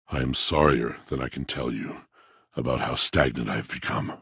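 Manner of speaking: soft, low and sorrowful; quiet regret. Acted. Apologizing to the traveler for being stagnant, quietly sorrowful. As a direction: let the apology sink to almost nothing.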